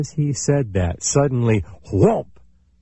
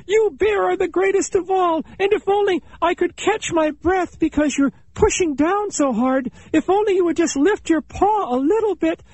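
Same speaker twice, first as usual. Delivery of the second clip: very high, quick pleading chipmunk voice; the pinned chipmunk begging the bear; flattering plea. A narrator's reading. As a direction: grovel and flatter to save your skin. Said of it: "Chipmunk knew now how foolish he had been".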